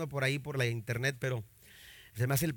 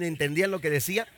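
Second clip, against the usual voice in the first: ordinary talk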